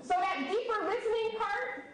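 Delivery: ordinary talk, nothing marked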